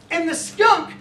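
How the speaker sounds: put-on high, loud little-boy voice; aggrieved young son. Son telling dad about his banged nose, imitated aggrieved child. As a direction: imitate a child's pointed accusation, drawn out.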